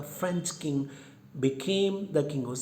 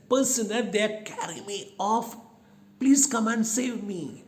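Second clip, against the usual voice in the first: high, loud pleading cry; desperate plea; 8 semitones higher, 5 dB louder than usual. Acted. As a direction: beg to be rescued, frightened. Kidnapped girl calls for help.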